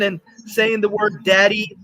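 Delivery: ordinary delivery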